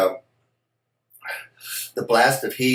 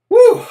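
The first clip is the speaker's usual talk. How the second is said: pained whoop; 11 dB louder than usual. Real, not acted. Reacting to burning hot pepper.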